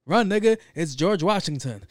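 loud, high, panicked yell of a warning; screaming at his friend to run. Retold or imitated. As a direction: shout it like you're fleeing for your life. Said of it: "And Dave Chappelle's like".

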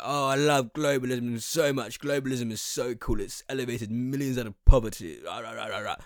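high, gushing imitation trailing into yaps; mock yapping gusher. Podcaster mocking free-market fans defending globalism, mock yappy gushing. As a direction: gush like a little yapping dog. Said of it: "like little yapping dogs".

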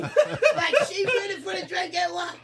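laughing shriek of disbelief; excited disbelief. Retold or imitated. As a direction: squeal it like you can't believe it. Recalling the crowd's disbelief.

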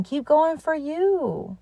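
mean it this time, straight to the listener; slow heartfelt push to keep going; sincere encouragement. Shown as a demonstration. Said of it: "But you really have to keep going".

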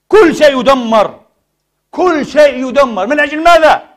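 loud, high and slow, hammering each phrase; outraged shout. Real, not acted. Preacher decrying the destruction of Syria, outraged shout. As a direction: thunder it at the congregation.

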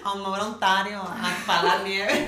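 a touch of a put-on Canadian-Spanish accent, laughing; mocking her own accent while laughing. Mocks her friend's move to Ontario with a put-on accent. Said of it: "¿por qué hablas así cuando me invitas?".

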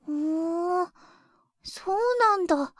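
quiet, half-whispered acknowledgment; soft murmur. Acted. Child to mother, musing in a near whisper. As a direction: trail off softly while thinking.

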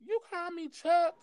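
offended high question; high incredulous. Retold or imitated. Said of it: "I was like".

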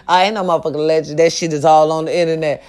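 ordinary talk

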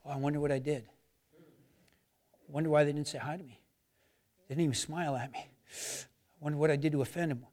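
low, hushed worried inner thoughts; the enemy's voice. Acted. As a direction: plant doubt softly, like a nagging thought in the head. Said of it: "what the enemy does is say".

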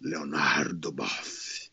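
low, drawn-out near-whisper; hushed name-drop. Retold or imitated. Retelling a cautious question to a zealot, hushed and drawn out. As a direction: let the name out very slowly and softly.

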